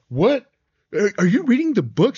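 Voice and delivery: high, loud mock-startled question; imitated startled disbelief. Jerry catching the little sister reading, imitated startled disbelief. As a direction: act out being caught off guard, voice jumping up.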